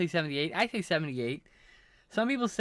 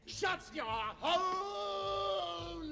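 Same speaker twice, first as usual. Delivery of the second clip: very high, drawn-out, softened scream; mock yell; 7 dB quieter, much slower than usual. Streamer telling self off, quiet high mock scream. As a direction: stretch out a tiny high-pitched holler.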